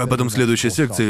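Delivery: ordinary delivery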